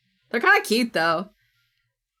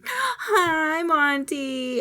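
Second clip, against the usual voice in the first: higher cutesy greeting; squeaky hello; 6 semitones higher, much slower than usual. Real, not acted. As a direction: coo a hello to a friend.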